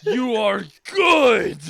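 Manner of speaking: slow, loud, far-off booming shout; Guy Sensei impression. Guy praising Lee, a slow booming shout. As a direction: boom it out like a proud mentor. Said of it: "And Guy goes".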